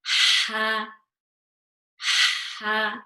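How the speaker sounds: ha with lots of air from the middle of the throat; breathy ha. Shown as a demonstration. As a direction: push air through ha. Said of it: "Lots of, lots of air".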